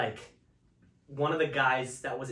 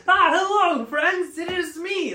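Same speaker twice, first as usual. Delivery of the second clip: very high, loud, badly faked British; hammed-up British greeting, pitched way up; mock British accent; 14 semitones higher, 8 dB louder, slower than usual. Shown as a demonstration. Comic shows how a British person talks, hammed-up accent. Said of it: "That's a British person".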